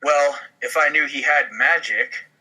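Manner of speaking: ordinary talk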